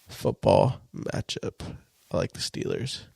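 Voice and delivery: yawning through the words. Real, not acted. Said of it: "I'm yawning".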